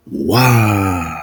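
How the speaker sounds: loud, forceful exhaled Wa like dragon breath; breathy Wa sound. Shown as a demonstration. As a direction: push Wa out like fire. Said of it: "The sixth letter is the".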